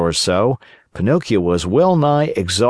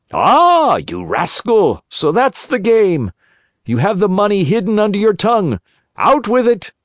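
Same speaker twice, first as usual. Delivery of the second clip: angry robber; 10 semitones higher than usual. A narrator's reading. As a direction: pounce on the trick. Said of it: "thieves discover the hidden gold".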